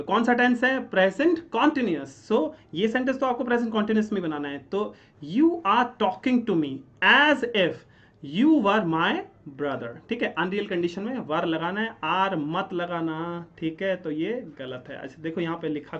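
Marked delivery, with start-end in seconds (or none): 5.2-10.1 slow dictation. Shown as a demonstration. Teacher dictates the correct sentence.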